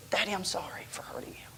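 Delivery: imitating a child's small whispered apology. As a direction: breathe it as a timid little whisper. Daughter whispers apology, retold.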